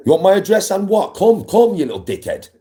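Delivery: high, loud challenge to come round; furious shout daring a troll to come to his door; angry taunting shout. Real, not acted. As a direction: yell the dare at them. Angry rant at viewers.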